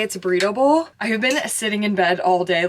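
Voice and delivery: ordinary talk, nothing marked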